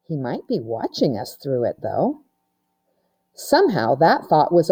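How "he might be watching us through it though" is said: lower, softer uneasy voice; half-whispered fear of being seen; hushed worry. A narrator's reading. Melanie fears the professor sees them, hushed and uneasy.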